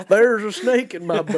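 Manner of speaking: high, loud cowboy line. Pretend. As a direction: say it like Woody. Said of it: "cowboy type things to say".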